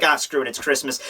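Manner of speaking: normal talking voice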